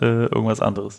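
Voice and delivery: ordinary talk, nothing marked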